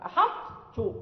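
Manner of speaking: أحط low, with a light laugh and the emphatic ط held before a doubled ت; أحط with ط heaviness kept, said with a chuckle; incomplete assimilation. Shown as a demonstration. Teacher gives students the correct أحط with a laugh. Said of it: "يعني ننطق بالإطباق".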